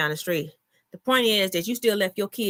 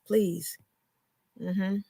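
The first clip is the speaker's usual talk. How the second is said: ordinary talk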